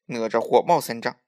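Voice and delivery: ordinary delivery, nothing marked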